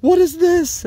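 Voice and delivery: very high, loud, building cry of pain; a raw scream that climbs with each repeat; retold anguished screaming. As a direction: scream it out as the pain tears through. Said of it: "And I just started screaming".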